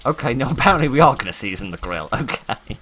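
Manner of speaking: loud, high, through laughter; laughing realisation. Real, not acted. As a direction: burst out laughing while conceding the mistake. Laughing at own game mistake.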